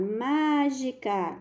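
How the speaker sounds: "Mágica" very slow, long accented MÁ; stress on accented syllable. Shown as a demonstration. Teacher showing the accent mark, "Mágica" stretched on MÁ. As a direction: draw out MÁ at the start of "Mágica". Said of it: "olha que ela tá acentuada".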